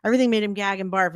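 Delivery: ordinary talk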